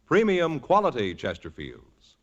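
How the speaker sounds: ordinary talk, nothing marked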